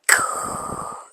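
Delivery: long breathy exhale; heavy sigh. Real, not acted. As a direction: let out a big, overwhelmed sigh. Overcome after reading quote aloud.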